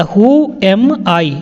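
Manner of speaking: "Who am I?" drawn out word by word; slow clear repetition. Shown as a demonstration. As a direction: say it slowly so learners follow. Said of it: "teacher repeats sentence slowly". (धीरे-धीरे साफ़ बोलना)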